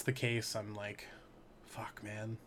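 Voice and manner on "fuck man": very soft, deflated whisper; resigned muttering. Acted. Seller venting about a delayed package, resigned whisper. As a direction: let it drop to a whispered sigh.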